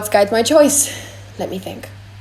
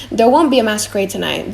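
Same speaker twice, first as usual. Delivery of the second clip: ordinary delivery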